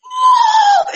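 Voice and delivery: very high, loud retold scream; shrieked imitation of a frightened child; mock terrified scream. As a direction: scream the alarm like a panicked kid. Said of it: "Reggie just screams".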